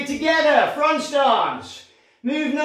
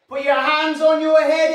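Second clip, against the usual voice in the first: ordinary delivery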